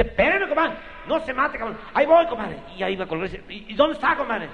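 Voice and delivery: high, loud, urgent pleading; alarmed compadre. Acted. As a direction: panic to stop a friend. Panicked compadre.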